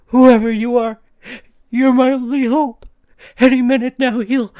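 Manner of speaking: high, loud frantic pleading; desperate begging. A narrator's reading. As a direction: plead as if he's coming for you. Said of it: "the woman begged".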